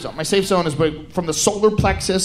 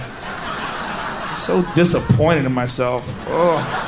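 self-disgust; 6 semitones lower, much slower than usual. Real, not acted. Self-disgust after Xbox.

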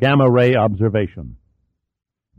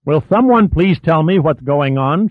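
exasperated, pitched higher; higher, mildly exasperated plea for an explanation; exasperated demand; 5 semitones higher than usual. A narrator's reading. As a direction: demand answers, fed up but contained. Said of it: "Sherman demanded in exasperation".

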